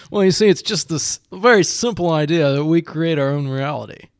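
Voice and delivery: lofty know-it-all lecturing tone; pompous voice. Pretend. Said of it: "I just sound like a pompous asshole".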